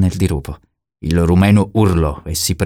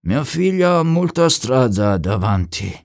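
slow, higher-pitched satisfied father; 7 semitones higher, slower than usual. A narrator's reading. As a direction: say it slowly, pleased about your daughter. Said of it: "disse Turcano soddisfatto". (soddisfatto, con calma)

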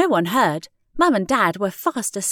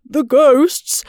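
loud, panting alarm; panted; 5 semitones higher, 7 dB louder than usual. A narrator's reading. Grisby tells Rebecca what is chasing them, panted. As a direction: pant it out in fright. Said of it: "panted Grisby".

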